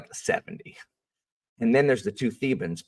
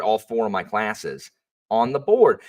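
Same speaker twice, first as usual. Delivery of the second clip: normal talking voice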